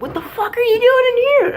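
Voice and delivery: high, far, loud mock mom shriek. Son mimics his mom yelling from the shower, high and loud. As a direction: squeal like a startled mom. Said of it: "my mom's like".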